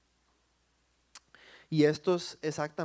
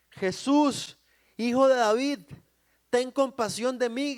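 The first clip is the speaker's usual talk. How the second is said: loud, high cry for mercy; shouted plea; 7 semitones higher, 6 dB louder than usual. Acted. Blind man calls to Jesus for mercy, shouting. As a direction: call out over a crowd. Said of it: "empieza a él a gritar".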